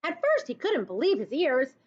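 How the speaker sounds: ordinary delivery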